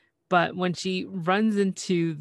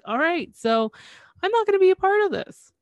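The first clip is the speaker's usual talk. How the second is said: much higher, louder and faster, nervous retreat; squeaky backing-out voice; 13 semitones higher, 6 dB louder than usual. Retold or imitated. Johnny ducks out of the siblings' fight, high nervous squeak. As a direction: squeak it out while sidling away. Johnny slipping away from argument.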